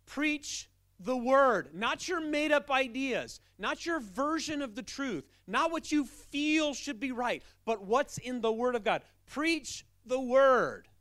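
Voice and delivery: slow, loud, high hammering of each phrase; full-force preaching. Acted. Pastor exhorting the congregation to preach the word, booming. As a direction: thunder it from the pulpit.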